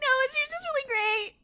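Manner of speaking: very high, rushed mock-distressed voice; hammed-up fast, high protest; upset roommate. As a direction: insist in an exaggerated, squeaky near-wail. Upset roommate repeats herself when pressed.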